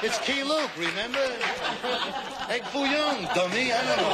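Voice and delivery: restaurant owner yelling. Acted. Restaurant owner calming the crazed soldier, high comic yell. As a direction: shout it like an indignant waiter. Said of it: "Chinese restaurant owners yell".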